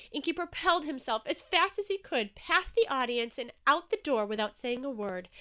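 plain reading voice

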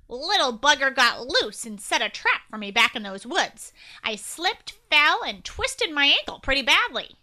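loud nasally agent voice. Acted. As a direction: push it all through the nose, official. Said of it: "said in a nasally voice".

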